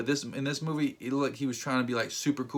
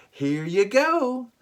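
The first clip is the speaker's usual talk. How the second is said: mocking told you so; 8 semitones higher, 4 dB louder, much slower than usual. Real, not acted. Scolding Spider-Man for consequences.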